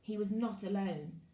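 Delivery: ordinary delivery, nothing marked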